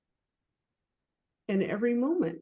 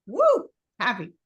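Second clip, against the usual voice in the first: mock cheer; 2 semitones higher, 5 dB louder than usual. Mocks a sudden burst of joy.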